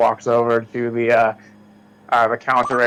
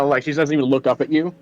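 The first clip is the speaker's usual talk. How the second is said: ordinary talk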